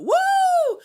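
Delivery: very high, loud whoop; re-enacted "woo". Showing cohosts the podcast lifting the mood, high whoop. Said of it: "that's when I went".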